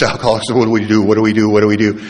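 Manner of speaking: loud, urgent repeated question; retold panic. Man gripped by dying patient searches for guidance, retold panic. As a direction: fire the question out over and over, mock-frantic.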